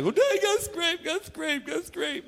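put-on high, whining little boy; whiny kid. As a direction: whimper it like a hurt child. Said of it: "was whining about".

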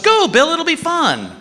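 high, slow, sing-song wife; wife's voice. Retold or imitated. Wife urging him to go out, high and slow. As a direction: coax him sweetly like a wife. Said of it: "My wife goes".

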